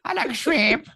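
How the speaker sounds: high mock wife voice; whiny wife impression. Husband imitating his wife's shrimp craving, high and whiny. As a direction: whine it like a petulant wife.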